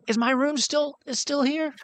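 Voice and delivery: put-on high excited girl's voice; excited little girl. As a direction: squeal it like an overjoyed child. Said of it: "as she screams".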